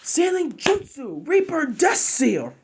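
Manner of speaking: high, slow ceremonial battle cry; drawn-out high jutsu shout; jutsu call. Acted. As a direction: chant the technique name out. Hiruzen casts the sealing jutsu.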